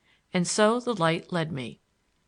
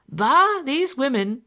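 scoffing; 7 semitones higher, 7 dB louder, slower than usual. A narrator's reading. Larry scoffing at women.

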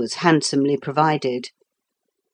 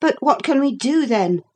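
put-on high-pitched impatient boy; 9 semitones higher than usual. A narrator's reading. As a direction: ask with a child's impatience, voice raised in pitch. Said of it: "said Philip impatiently".

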